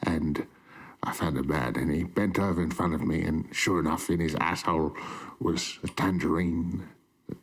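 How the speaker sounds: a low, lightly put-on British butler; mock Alfred telling a crude story, half-hearted; worst accent ever. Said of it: "worst accent ever".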